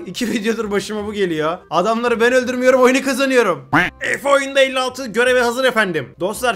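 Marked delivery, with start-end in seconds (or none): none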